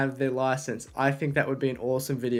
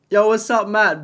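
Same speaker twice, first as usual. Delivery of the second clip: loud hype greeting; 6 semitones higher, 8 dB louder than usual. Real, not acted. Greeting a new subscriber loudly.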